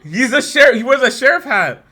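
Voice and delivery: high, loud protest; worked-up, high-pitched arguing; exasperated insisting. Real, not acted. Arguing excitedly about the sheriff hat.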